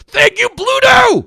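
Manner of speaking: high, loud excited thank-you; loud shout. Real, not acted. Calling out donor thanks.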